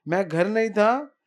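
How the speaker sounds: loud indignant scolding; angry rebuke. Acted. Dau scolding Yashoda for being a bad mother, loud angry. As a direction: tear into her with fury.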